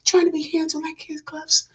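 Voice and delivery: high, hushed, dainty mockery; mock soft voice. Mocking a man who talks soft to be taken seriously, high and whispered. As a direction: fade to a whisper, mocking being too soft. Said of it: "be talking like this and you trying to be all soft".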